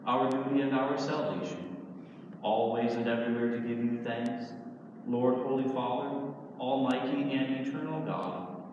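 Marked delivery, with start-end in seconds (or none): none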